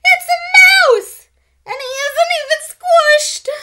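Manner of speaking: very high, loud squeal. A narrator's reading. Karen discovers a live mouse in her bed, squealing. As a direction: shriek it in horrified alarm. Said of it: "squealed Karen".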